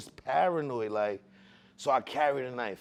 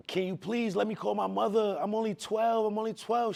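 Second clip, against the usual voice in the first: pleading; 7 semitones higher than usual. Retold or imitated. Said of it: "I'm pleading to this lady".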